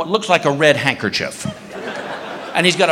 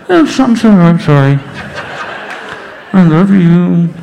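loud, muffled snorkel talk; talking through snorkel; 4 dB louder than usual. Retold or imitated. Husband apologizes to wife underwater, loud snorkel voice. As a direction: imitate shouting through a mouthpiece. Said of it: "talking to her through the snorkel".

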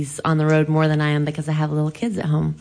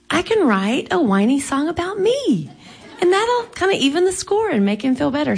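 high, lilting mock-eureka; bright idea; 8 semitones higher than usual. Wife retells deciding to cheer up her husband, playfully excited. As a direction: lift the voice high as the idea dawns.

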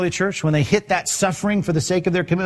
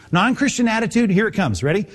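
ordinary talk, nothing marked

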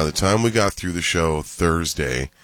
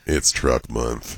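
low, slow truck ad read; truck commercial voice; 6 semitones lower, much slower than usual. Acted. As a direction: drag it out like a truck spot. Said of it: "We need all truck commercials read by you".

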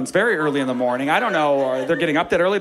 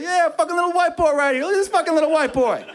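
hyped teammates; 12 semitones higher than usual. Retold or imitated. Teammates celebrating his bucket, high excited yell. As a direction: cheer him on like the crowd went wild.

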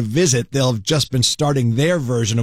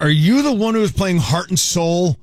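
higher voice of a star-struck fan; lightly imitating an excited listener; gushing fan; 5 semitones higher than usual. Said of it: "She's like".

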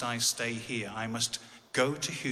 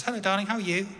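high, louder phone greeting; voiced wife; 9 semitones higher, 5 dB louder, faster than usual. Wife phoning husband on tour, high voiced greeting. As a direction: imitate a wife's high-pitched hello.

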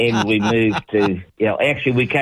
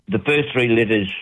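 ordinary talk

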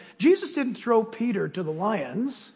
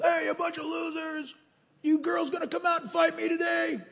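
Goliath taunting; 7 semitones higher, 5 dB quieter than usual. Retold or imitated. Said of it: "Goliath got up and yelled at Israel".

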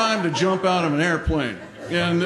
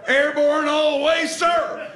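gung-ho cadet call; 9 semitones higher than usual. Retold or imitated. Cadet showing off to the instructor after landing, gung-ho. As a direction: call it out like an eager parachute cadet.

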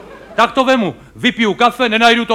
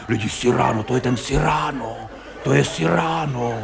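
1 hushed awe; 6 semitones lower, 7 dB quieter than usual. Retold or imitated. Awed spectator whispering about Cyrano.

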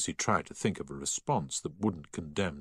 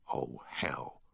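breathy whispered oath, lower and soft; whispered curse; 5 semitones lower, 7 dB quieter than usual. Acted. Harry curses under his breath at the news. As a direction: mutter it under the breath in dismay. Boy's dismayed aside.

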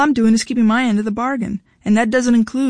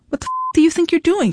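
high, sharp furious demand; 8 semitones higher than usual. A narrator's reading. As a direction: demand it, outraged. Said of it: "she demanded".

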